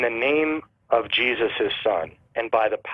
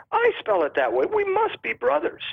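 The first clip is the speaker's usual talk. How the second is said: mock amazement. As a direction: pitch leaping high in feigned excitement. Acts out absurd discovery of brotherhood.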